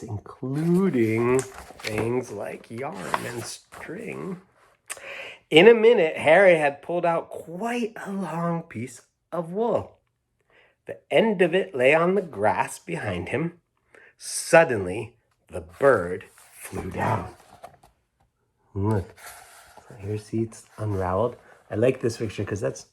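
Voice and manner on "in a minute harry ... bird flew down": lively storybook telling that drops to a hush; reading the bird's swoop to little listeners; reading to children. Acted. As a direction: build the moment, then let it fall. Reads the story aloud to children.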